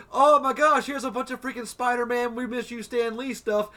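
high, loud fake gushing; mocking gush. Mocking fans who mourn Stan Lee, high and loudly gushing. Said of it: "they were all like".

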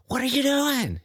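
high mock-affronted question; mock indignant lady. Owner blaming the tumbling runner, high mock indignation. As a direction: parody the owner's outrage. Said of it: "And it's like".

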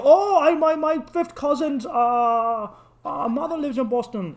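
high, gushing fake enthusiasm; mock a hustler faking a connection; overfriendly scammer. Street scammers claim Boston family to his brother, high and overeager.

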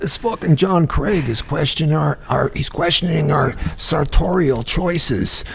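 lightly voiced coworker mocking, slowing at the end; a hint of an offended colleague griping. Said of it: "He's, like, walking around going".

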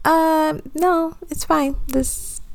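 a hint of an unconcerned boss; dismissive boss. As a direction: wave it off in a high, unbothered voice. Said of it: "my boss was like".

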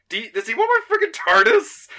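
high, loud mock disbelief; incredulous outburst. The Doctor balking at a request for his device, incredulous. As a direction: squeal the question, loudly incredulous.

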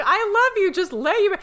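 loud, high mock plea; high pleading gush. Fan pleading with actor, high and gushing. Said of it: "It's like".

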